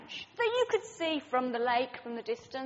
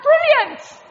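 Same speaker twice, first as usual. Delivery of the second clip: loud, high ironic cry of Brilliant; sarcastic exclamation; 10 semitones higher, 13 dB louder than usual. Retold or imitated. [name] hearing they'd be crushed, a loud sarcastic cry. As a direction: shout it in mock delight, really horrified. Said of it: "And I was like".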